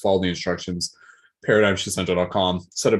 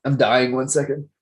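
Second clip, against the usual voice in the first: coughing up water; 4 semitones higher than usual. Real, not acted. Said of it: "talking and swallowing at the same time".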